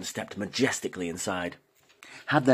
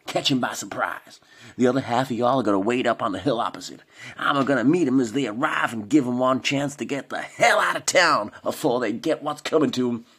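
cowboy orders; 4 semitones higher than usual. A narrator's reading. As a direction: command the posse like a B-movie sheriff, American accent. Norman lays out plan.

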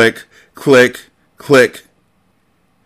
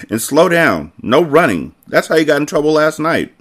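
put-on higher-pitched babysitter warning; mild scolding; 8 semitones higher than usual. A narrator's reading. Babysitter chasing boys upstairs, mild scolding. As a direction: rein the kids in firmly.

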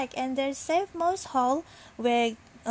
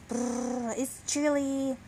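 shivering grumble; slower than usual. A narrator's reading. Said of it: "he grumbled to himself".

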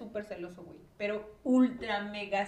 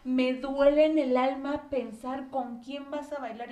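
slow, louder, aching lovesick plea; mock lovesick boyfriend; 4 semitones higher, 10 dB louder, slower than usual. Friend mocks her ex's jealous letter, slow aching plea. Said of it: "decía así como de".

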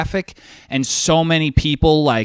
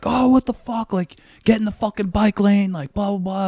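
high, mock yell without much volume; retold screaming; 7 semitones higher than usual. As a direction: imitate an irate driver in a higher voice. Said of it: "he's screaming at me".

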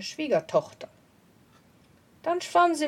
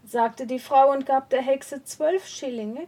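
ordinary delivery, nothing marked

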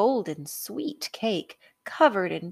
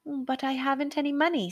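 put-on higher, fast worried boy; boy pleading; 8 semitones higher, faster than usual. A narrator's reading. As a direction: protest like an anxious boy. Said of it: "said Jack".

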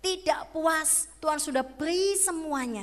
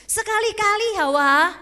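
a loud, sly tempter; the devil tempting; 6 dB louder than usual. Acted. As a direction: play the serpent luring Eve, pushing hard. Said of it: "Iblis bilang apa?".

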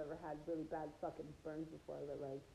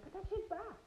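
high, sharp shouted order; yelling at his bros to put the TV back; retold yell; 13 semitones higher than usual. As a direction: bark at your friends to put it back. Yelling at friends to return TV.

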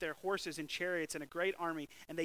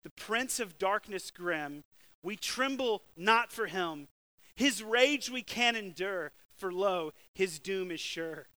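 slow, louder, weighty recitation; hymn recited; 4 dB louder, slower than usual. Shown as a demonstration. Preacher quoting Luther's hymn, slow and solemn. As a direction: declaim the hymn lines with gravity. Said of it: "This is what he says".

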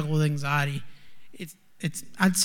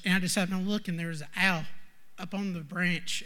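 usual speaking voice

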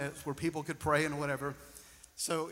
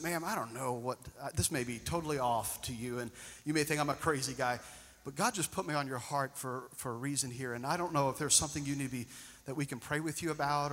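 softer, lower, careful words to a stranger; gentle approach; 3 semitones lower, 8 dB quieter than usual. Retold or imitated. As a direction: ease into it tenderly, unsure. Speaking gently to the server.

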